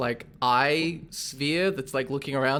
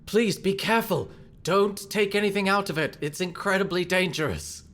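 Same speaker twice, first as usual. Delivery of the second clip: anxious warning; 6 semitones higher than usual. Acted. Galahad warns the player.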